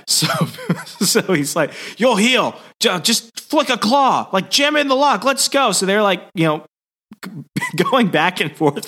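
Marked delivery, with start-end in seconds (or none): 2.0-5.7 high-pitched, exasperated hurrying along; impatient urging. Retold or imitated. Wolverine hurrying injured Peter to open the lock, impatient urging. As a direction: brush off the complaint and push him on. Acted impatient orders to partner.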